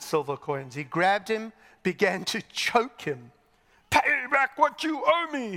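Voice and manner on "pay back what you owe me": loud, high, strangled demand; harsh acted outburst; angry demand. A narrator's reading. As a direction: demand it as if choking a debtor. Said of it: "he demanded".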